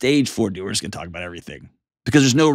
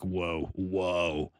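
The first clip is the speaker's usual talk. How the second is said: soft mock whoa; 7 semitones lower, 13 dB quieter than usual. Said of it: "unless it's like".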